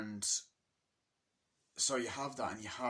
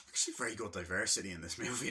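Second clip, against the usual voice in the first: rushing; faster than usual. Real, not acted. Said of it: "I'm talking too fast".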